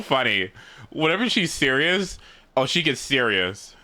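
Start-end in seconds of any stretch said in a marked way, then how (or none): none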